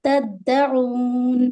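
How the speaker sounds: تدعون slowly, with a held double d; chanted تدعون with doubled d; doubled daal. Shown as a demonstration. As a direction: lean on both d sounds in تدعون, then stretch the oo. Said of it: "دو بار دال کی sound دیں گے".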